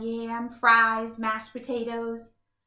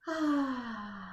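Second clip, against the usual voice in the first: peaceful sigh; 5 dB quieter than usual. Acted. Relaxing under the tree.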